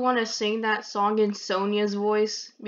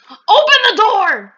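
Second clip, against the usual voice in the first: loud, higher, slow demand; shouting; 9 semitones higher, 14 dB louder, slower than usual. Real, not acted. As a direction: yell at your friend to open the door. Shouting to get let in.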